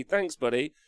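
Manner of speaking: ordinary talk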